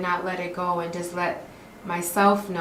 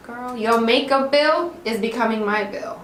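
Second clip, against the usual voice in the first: sassy mom remark; 5 semitones higher, 5 dB louder than usual. Retold or imitated. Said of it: "I'm like".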